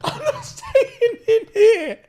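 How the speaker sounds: slow, high mock-tearful wail; crying and stressed. Terrified roommate refusing to stay, slow imitated high wail. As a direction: wail it, imitating a distraught friend. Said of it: "he's crying and he's stressed".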